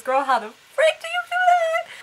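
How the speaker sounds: high, loud, drawn-out incredulous outburst; exasperated disbelief. Real, not acted. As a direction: blurt it out high and loud, stretching the words. Appalled at the heroine's lie.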